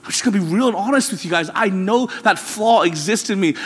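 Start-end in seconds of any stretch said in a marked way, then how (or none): none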